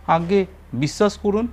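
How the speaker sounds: pleading thief. A narrator's reading.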